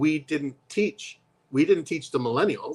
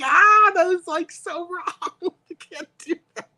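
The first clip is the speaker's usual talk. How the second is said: high, laughing cry of disbelief; mock horror; 5 dB louder, slower than usual. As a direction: act scandalized through laughter. Acted outrage at lazy tutors.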